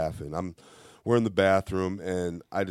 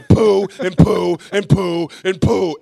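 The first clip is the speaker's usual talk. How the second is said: loud, high rhythmic repeated bursts; imitated sputtering rage; mock furious outburst; 11 semitones higher, 10 dB louder, slower than usual. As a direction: puff out each poo like an angry dad. Mimics angry Joel's silly repeated outburst.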